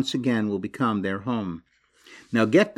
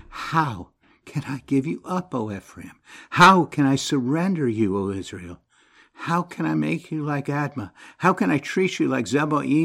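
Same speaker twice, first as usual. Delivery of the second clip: a passionate, pleading reading of the verses, higher; scripture reading; 5 semitones higher than usual. Acted. Preacher reading God's lament to the congregation, pleading.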